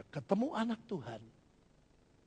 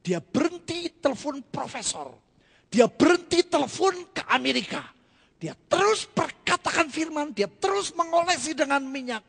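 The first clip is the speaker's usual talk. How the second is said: preaching, rising higher and louder; building sermon; 8 semitones higher, 8 dB louder than usual. Acted. Preacher tells the congregation how she stopped calling doctors, building. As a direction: build the testimony up to full force.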